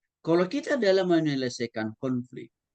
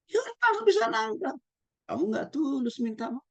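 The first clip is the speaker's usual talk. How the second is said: shrill tone; 9 semitones higher than usual. Acted.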